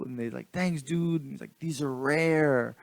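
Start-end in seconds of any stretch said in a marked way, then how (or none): 0.5-1.2 put-on higher-pitched boy's thanks; lightly voiced young boy; imitating grateful kid. Retold boys thanking him for bills. 1.6-2.8 put-on slow, drawn-out kid's marvel; lightly voiced wowed child; imitating impressed kid. Retold boy marvels at the bills.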